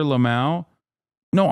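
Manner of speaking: ordinary talk, nothing marked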